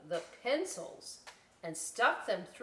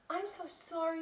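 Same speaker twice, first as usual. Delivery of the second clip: higher voiced little bat, sorry; a small bat apologizing in a raised voice; apologetic Little Bat; 10 semitones higher than usual. Little Bat apologizing for spilled juice, higher voice. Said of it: "Little Bat cried".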